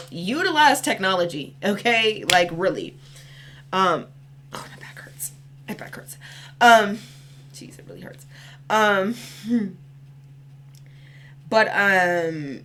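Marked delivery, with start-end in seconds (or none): 4.5-6.2 barely voiced, pained whisper; real under-the-breath grumble; whispered aside. Real, not acted. Pained aside about her aching back. 7.5-8.2 pained whisper, no voice; real under-the-breath complaint; whispered aside. Real, not acted. Pained aside about her aching back.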